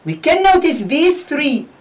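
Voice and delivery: ordinary talk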